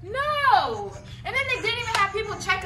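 loud, high, drawn-out protest; exasperated complaint. Real, not acted. Guest griping about the bottom-floor room, exasperated. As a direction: complain with stretched, emphatic words.

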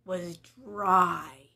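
put-on lively storybook narration for kids; story reading. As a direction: read it like a picture book to little ones. Reading a Bible story to children.

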